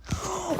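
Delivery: sharp high intake of breath; shocked gasp. Real, not acted. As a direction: gasp in amazement. Gasps at a rare pet.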